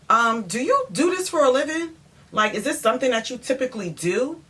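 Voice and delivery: high-pitched, disbelieving question; incredulous questioning. Retold or imitated. Acts out confronting the painter.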